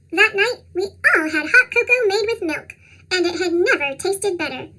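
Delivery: usual speaking voice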